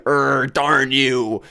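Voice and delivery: hammed-up mock chef's cartoon grumble; a chef reduced to a silly growl; Gordon Ramsay fuming. Podcaster imagining the chef judging food like himself, mock grumble. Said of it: "he's like".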